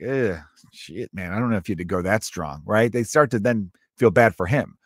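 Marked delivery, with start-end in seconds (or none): 0.0-2.6 low, hushed disapproving aside; uneasy audience. Retold or imitated. Audience reacting to a comic gone too hard, uneasy. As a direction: imitate an uncomfortable crowd, low and soft.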